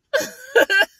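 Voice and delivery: big laugh. Real, not acted. Laughing at her own story.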